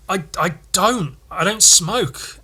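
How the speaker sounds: retold defensive stammer, drawn out at the end; flustered reply. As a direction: stammer out a helpless excuse. Retold flustered reply to angry woman.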